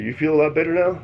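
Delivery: sly, knowing question; teasing. Retold or imitated. Teasing wife about peeing.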